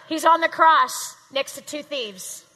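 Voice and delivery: loud, slow passionate sermon delivery; preaching. Acted. Preacher telling the class about the cross, loud and slow. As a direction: drive the point home like a preacher.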